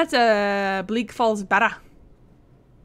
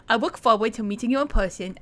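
ordinary talk

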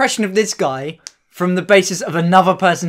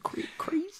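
whisper; 9 semitones higher, 19 dB quieter than usual. Retold or imitated. Whispered wow mimicry.

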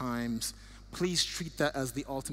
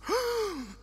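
a high sharp gasp of surprise. Real, not acted. Reading a friend's note, a sharp gasp. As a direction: catch your breath, struck by it. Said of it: "'cause I just thought".

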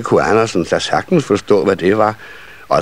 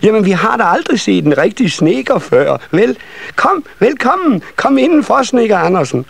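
very high, eager little voices; excited child welcome; 14 semitones higher than usual. A narrator's reading. Nisse children inviting carpenter Andersen in, high and excited. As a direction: squeal a welcome like small children.

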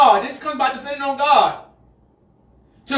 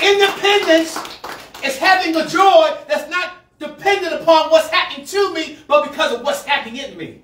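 higher and louder, driving the word home; preaching; 5 semitones higher, 5 dB louder than usual. Real, not acted. Pastor declaring joy to the congregation. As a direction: lift it up and proclaim.

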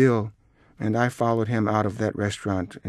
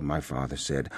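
ordinary delivery

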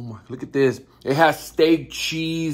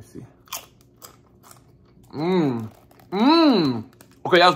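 savoring food while humming; chewing with mouth closed; 3 semitones higher, 4 dB louder than usual. Real, not acted. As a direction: mouth partly full. Seen 0: chip eaten at -2, closed-mouth chewing, sips salsa during.

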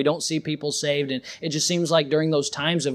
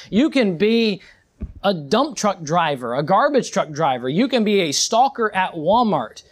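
ordinary talk

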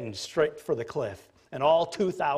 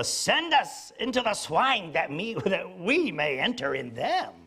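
slow, higher hammed-up demon plea; dramatic demon voice; 5 semitones higher than usual. Preacher mimicking the audio Bible's pleading demons, hammed-up. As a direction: ham up the devils begging. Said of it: "I blame it all on those dramatic Bibles".

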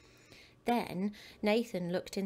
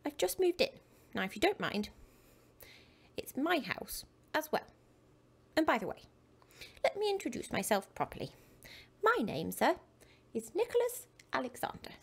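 proud little gentleman; 6 semitones higher than usual. Acted. Nicholas introduces himself proudly.

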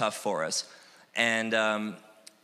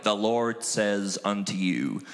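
slow, lower, portentous pronouncement; mock prophet; 4 semitones lower, slower than usual. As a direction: parody a solemn prophecy. Mocks a solemn prophecy style.

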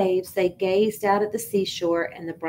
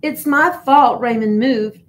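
sharp, louder self-blaming snap; upset boy snapping at himself; snapped; 4 semitones higher, 6 dB louder than usual. Acted. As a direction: bite the words out. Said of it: "Gilbert snapped".

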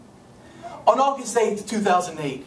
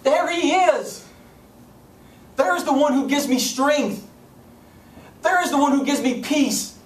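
high, fervent proclamation; exultant witness; 6 semitones higher than usual. Acted. Grieving family points people to Jesus beside John, exultant. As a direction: proclaim it like pointing him out to a crowd. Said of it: "standing there with John saying".

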